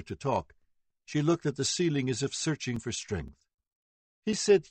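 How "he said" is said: high, louder, tearful telling; distressed. A narrator's reading. Victim telling the jury, high and distressed. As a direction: near breaking as she recounts.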